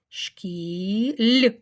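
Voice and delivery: "Шкіль" stretched for spelling; sound by sound. Shown as a demonstration. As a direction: dictate "Шкіль", holding і and then ль. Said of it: "Тут І і м'який знак".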